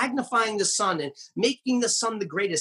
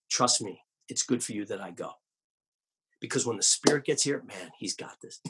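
low and soft, a quiet promise; Jesus quietly reassuring his disciples; gentle reassurance; 10 semitones lower, 5 dB quieter than usual. Acted. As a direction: soothe them with a hushed, confident voice.

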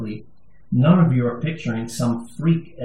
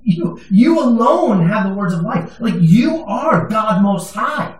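a touch of the disciples pleading; disciples' reply; 8 semitones higher, 5 dB louder, slower than usual. Retold or imitated. Disciples answering Jesus, pleading and fervent. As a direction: answer Jesus with urgent conviction. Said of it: "They said".

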